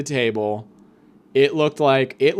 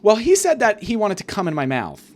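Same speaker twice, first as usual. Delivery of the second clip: high put-on daughter voice; mock girlish high delivery; Tony's daughter; 7 semitones higher, 3 dB louder than usual. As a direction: play the daughter reporting it, pitched up. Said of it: "And she's like".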